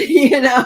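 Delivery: loud, bursting laugh. Real, not acted. Reader laughing at the client running into her ex at IHOP, loud laugh. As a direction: crack up at the idea.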